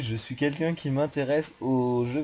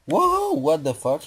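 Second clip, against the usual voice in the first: surprised; 6 semitones higher, 7 dB louder, slower than usual. Real, not acted. Startled by something in game.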